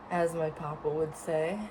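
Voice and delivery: normal talking voice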